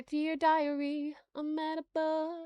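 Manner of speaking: a hint of a dreamy schoolgirl; girlish diary voice. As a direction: read it as a little girl confiding. Reading diary as Poppy.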